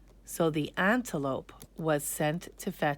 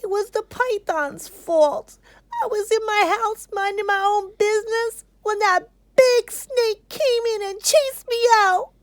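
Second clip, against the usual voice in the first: much higher, timid little rabbit; a scared small creature pleading; 5 dB louder than usual. Acted. The rabbit pleading with King Lion, high and timid. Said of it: "said the rabbit".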